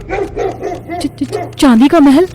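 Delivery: high, stammering frightened voice; frightened realisation. Acted. Girl realises she is in the silver palace, stammering in fear. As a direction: stutter in dawning terror.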